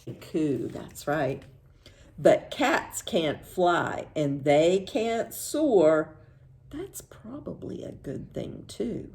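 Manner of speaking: usual speaking voice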